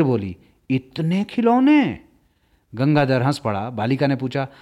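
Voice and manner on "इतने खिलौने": amazed. A narrator's reading. Girl amazed at toy pile.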